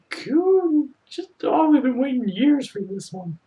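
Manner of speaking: squealing with delight, rising to a high squeal; gushing excitement. Real, not acted. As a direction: swoon over something adorable. Gushing over a cute character.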